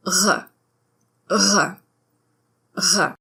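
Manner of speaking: "R" as a dry gargle; French R. Shown as a demonstration. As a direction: gargle the "R" dryly at the back of the mouth. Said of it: "The French R is essentially a dry gargle".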